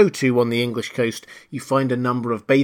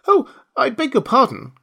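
high, louder cry of apology; hasty apology; 12 semitones higher, 5 dB louder than usual. A narrator's reading. Alice fears she hurt the mouse's feelings, hastily apologetic. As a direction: blurt it out as a startled Alice. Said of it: "cried Alice hastily".

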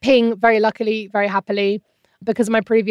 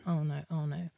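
dread; 4 semitones lower, 16 dB quieter than usual. Retold or imitated. Scan staff fetching someone else.

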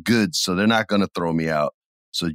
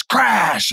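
mock crash; 11 semitones higher, 3 dB louder than usual. Imitating lightning crash.

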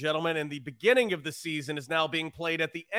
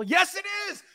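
excited shout. Acted. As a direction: shout it like a hype man. Hyping last baseball weekend.

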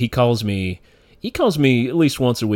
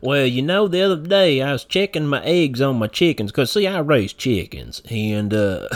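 small-town country rambler; 4 semitones higher than usual. Acted. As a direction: ramble folksily in a put-on rural accent. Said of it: "if they talk slower".

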